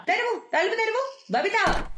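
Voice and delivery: high, insistent call to open the door; woman yelling at her neighbour to open the door; angry call. Acted. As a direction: pound the door with the voice. Demanding door opens.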